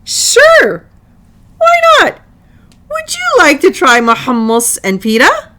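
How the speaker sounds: loud, high, cheerful; happy grin. Acted. Selma happily offers her hummus to Lily, loud and high. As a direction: beam as you offer your lunch back. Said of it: "Selma grinned".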